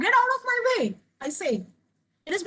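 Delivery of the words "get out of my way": high, forceful exclamatory read; expressive exclamation. Shown as a demonstration. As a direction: shout it as the novel's heroine. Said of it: "அப்பதான் எக்ஸ்பிரசிவா படிக்க முடியும்".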